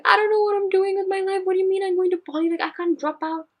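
high-pitched panicked wail, retold; mock meltdown, very high, loud and rushed; hysterical, imitated. Daughter phoning her parents about dropping out, imitated hysterics. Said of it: "I started hysterically crying again".

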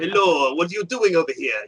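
hammed-up Russian accent; playful mock Russian accent; fake Russian accent. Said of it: "fake Russian accents".

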